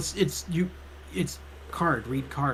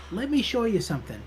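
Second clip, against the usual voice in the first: higher-pitched librarian in a game; librarian character voice; 6 semitones higher, 5 dB quieter than usual. Acted. Librarian offers to show visitor a book, character voice. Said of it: "which voice you use for her".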